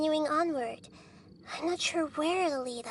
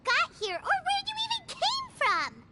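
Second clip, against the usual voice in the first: raised, heated objection, pitched up; upset protest; 10 semitones higher than usual. Acted. Companion protesting to a dreamer, heated and raised. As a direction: push back hard, indignant and high.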